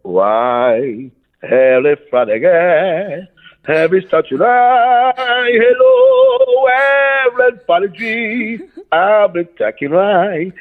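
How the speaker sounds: high, slow, crooning nonsense English; sing-song fake English, hammed up; fake English gibberish. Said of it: "aquele embromation". (embromation em inglês falso)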